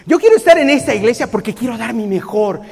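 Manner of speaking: loud, bright overeager vow; eager mock enthusiasm. A newcomer promising to be perfect, overeager and loud. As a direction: play the idealistic newcomer, overdoing it. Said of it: "nadie busca ... pensando en ser la mejor persona".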